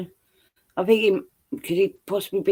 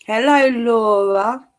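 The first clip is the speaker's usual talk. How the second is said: drawn-out greeting; 5 semitones higher, much slower than usual. Real, not acted. Playful greeting to a co-host.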